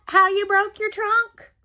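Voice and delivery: high, excited pig voice; Piggy voice. Acted. Piggy asks Gerald about his trunk, high piggy voice. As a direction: squeal it out as Piggy.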